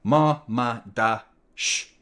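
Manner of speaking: "Marmadash" said slowly, every syllable split apart. Shown as a demonstration. Streamer counting the keeper's syllables, slow. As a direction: break "Marmadash" into slow beats. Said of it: "Is dash one syllable?".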